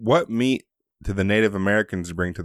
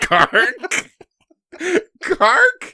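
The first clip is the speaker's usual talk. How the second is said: squeaky high amused disbelief; mock the slip with a rising squeal; real amused disbelief; incredulous laughing echo; 8 dB louder than usual. Real, not acted. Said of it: "I don't know".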